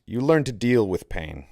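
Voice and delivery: usual speaking voice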